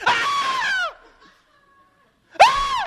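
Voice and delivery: shrieked scream, very high and loud; drunk's terrified scream. Retold or imitated. Drunk looks into the coffin, screaming in fright. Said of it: "he goes".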